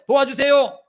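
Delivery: high, loud plea; crying out for help. Shown as a demonstration. Believer pleading to God, high and loud. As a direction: cry it out to God. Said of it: "하나님께 막".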